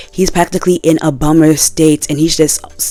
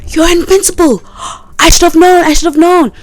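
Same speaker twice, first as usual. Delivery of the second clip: high squeaky shocked friend voice; squealing best friend; 13 semitones higher, 3 dB louder than usual. Retold or imitated. Best friend finds out Mark's secret, high shocked squeal.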